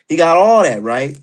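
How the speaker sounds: ordinary talk, nothing marked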